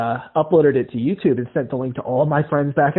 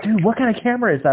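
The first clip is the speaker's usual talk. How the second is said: higher and quicker; eager Randy; 10 semitones higher, faster than usual. Retold or imitated. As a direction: rattle the question off with excitement. Randy asks about the camera.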